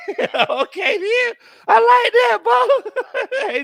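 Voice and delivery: high, loud laughing; laughing loud and high at a viewer's comment. Real, not acted. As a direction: crack up loudly at the comment.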